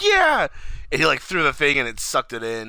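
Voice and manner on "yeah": very high, loud mock cheer; hammed-up triumphant cartoon yell; imitating JP. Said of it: "'cause then he's like".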